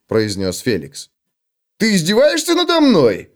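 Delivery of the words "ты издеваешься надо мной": high, affronted question; indignant. Acted. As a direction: demand if he is mocked. Dwarf indignant. (возмущённый вопрос гнома)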